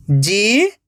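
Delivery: जीत split into जी, pause, त; drawn-out जी rising, then त alone; syllable by syllable. Shown as a demonstration. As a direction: sound out जीत in two separate parts. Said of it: "एक एक अक्षर का उच्चारण करना है".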